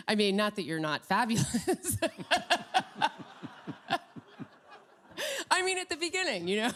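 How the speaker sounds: talking through laughter; laughing. Real, not acted. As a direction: laugh while you say it. Laughing at her own joke.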